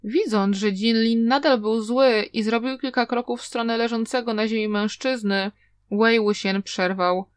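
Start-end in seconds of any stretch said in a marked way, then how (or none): none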